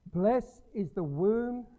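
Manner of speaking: loud, slow proclaiming; scripture reading. Acted. Preacher reading Jesus's reply to the crowd, loud and slow. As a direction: draw each word out to the hall.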